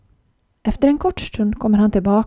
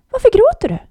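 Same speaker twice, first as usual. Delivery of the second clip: higher and louder, a small unseen animal calling; tiny hidden creature voice; 12 semitones higher, 7 dB louder, faster than usual. A narrator's reading. Shrew calls to the crying girl, high and louder. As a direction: pipe it up from nowhere, high and loud.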